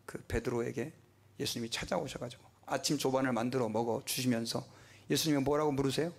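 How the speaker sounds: ordinary delivery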